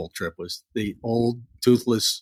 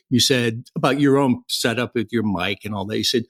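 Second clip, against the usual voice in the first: ordinary talk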